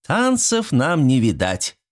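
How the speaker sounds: slow, high collective reply; women's chorus. A narrator's reading. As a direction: chime in like the village women. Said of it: "откликнулись хором женские голоса".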